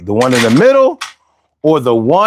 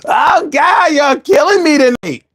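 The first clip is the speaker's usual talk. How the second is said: cracking up; 11 semitones higher than usual. Real, not acted. Streamer cracking up at chat.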